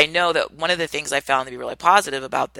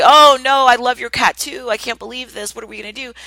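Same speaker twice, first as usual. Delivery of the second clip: high panicky gush, hammed-up; mock hysterical; 8 semitones higher, 6 dB louder than usual. Friend overreacting to the vet news, mock hysterical. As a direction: play the friend falling apart over the news.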